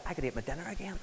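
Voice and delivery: softly retold late-night grumble; quiet murmur in bed. Husband hungry in bed telling his wife, quiet murmur. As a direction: murmur it low like you're lying in bed hungry. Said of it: "said to Lisa".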